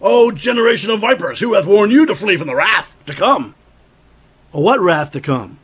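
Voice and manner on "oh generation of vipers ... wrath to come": fiery rebuke. Retold or imitated. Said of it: "what he says is".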